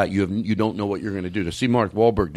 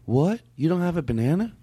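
imitating clip voice; 7 semitones higher than usual. Shown as a demonstration. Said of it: "Now let's try a sentence".

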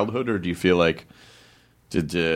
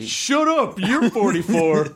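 high, incredulous outburst; high, stretched cry of astonishment; joking disbelief; 8 semitones higher, 3 dB louder, much slower than usual. Real, not acted. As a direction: burst out in disbelief, then draw it out. Disbelief at the guest's age.

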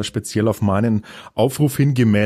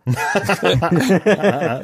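high laugh; 8 semitones higher than usual. Real, not acted. Reacts to hipster joke. (lautes Lachen)

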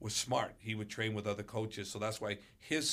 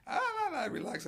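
laughing father; faster than usual. Retold or imitated. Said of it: "And he starts laughing".

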